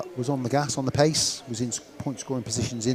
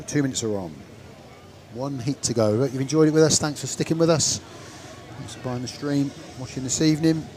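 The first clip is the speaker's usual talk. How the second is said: louder, higher rising announcer call; 3 semitones higher, 7 dB louder than usual. Acted. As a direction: build it up like a stadium announcer. Announcing final heat line-up.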